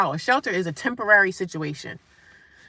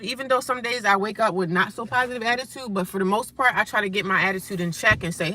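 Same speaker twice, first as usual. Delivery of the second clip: ordinary talk, nothing marked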